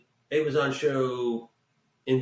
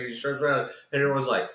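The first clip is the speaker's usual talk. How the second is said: usual speaking voice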